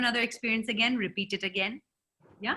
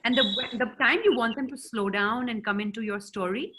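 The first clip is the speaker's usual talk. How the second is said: normal talking voice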